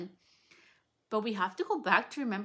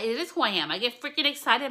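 pitched up and louder with excitement; excited; 5 semitones higher, 6 dB louder, faster than usual. Real, not acted. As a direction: let the voice jump up with real excitement. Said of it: "My voice pitches".

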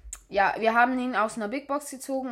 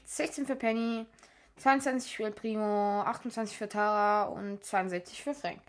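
usual speaking voice

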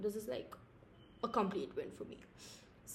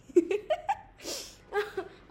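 loud, high burst of laughter; 12 semitones higher, 8 dB louder than usual. Real, not acted. Laughs at own joke.

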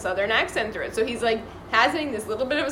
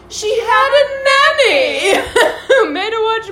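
high laughing mock voice; quoting the woman; 11 semitones higher, 10 dB louder, slower than usual. Woman explaining to Jackson, high and laughing. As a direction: squeal it out through laughter. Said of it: "she goes".

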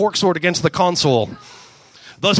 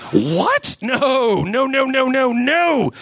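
higher, alarmed string of no's; mock shout; 7 semitones higher, much slower than usual. Gamora recoiling from Rocket, higher mock shout. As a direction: back away, panicking. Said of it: "shouted Gamora".

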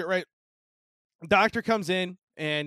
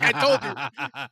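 slow, high, drawn-out told you so; mock-smug slow gloat; 8 semitones higher, much slower than usual. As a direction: stretch it out, smug and knowing. Retelling smug reply to nurse.

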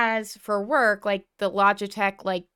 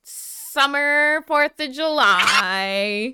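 slow, grand commercial announcer; 4 semitones higher, 5 dB louder, much slower than usual. Pretend. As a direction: sell it like a summer ad. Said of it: "that sounded like a, like a magazine".

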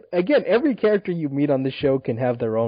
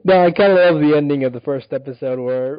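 ordinary talk, nothing marked

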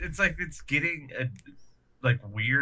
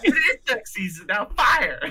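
high, laughing cartoon outburst; hammed-up cartoon hunting squabble; Looney Tunes; 11 semitones higher, 4 dB louder than usual. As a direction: shout it high, through laughter. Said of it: "Kinda like Looney Tunes".

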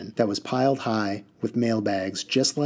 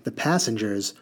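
ordinary delivery

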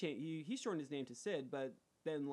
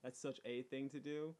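usual speaking voice

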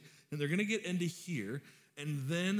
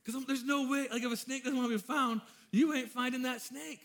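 exasperated; 9 semitones higher, 4 dB louder than usual. Real, not acted. Exasperated about hunting a snake.

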